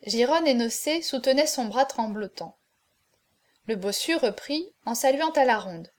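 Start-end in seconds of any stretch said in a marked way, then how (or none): none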